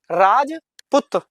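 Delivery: drawn out syllable by syllable, slow; slow sounding out. Shown as a demonstration. Teacher reading Rajput to pupils, slowly drawn out. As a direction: stretch Rajput slowly, each syllable clear. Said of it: "क्या पढ़े?".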